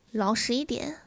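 sudden loud, firm order; stern warning. Acted. Wife giving a last warning, loud and stern. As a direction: raise the voice on a short final warning. Final warning during interrogation.

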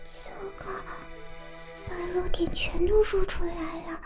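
hushed whisper to herself; whispered aside. Acted. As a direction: mutter it under your breath, caught out. Aside after revealing weaknesses.

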